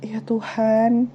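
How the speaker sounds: slow, soft imitation of a pleading prayer; hushed mother's plea to God; praying mother. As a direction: pray quietly, drawing the words out. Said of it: "ibunya tuh berdoa di situ".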